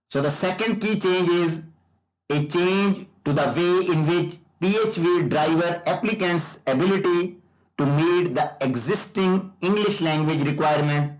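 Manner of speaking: usual speaking voice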